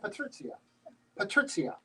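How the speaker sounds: "Patrizia" with ts in place of sh; Polish pronunciation. Shown as a demonstration. Learner repeating the name after the speaker, Polish pronunciation. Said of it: "in Polish it's Patrizia".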